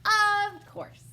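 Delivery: high, loud chirpy reply; cheery Hare. Acted. Hare answering Bear, loud and cheery. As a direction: answer brightly, full voice.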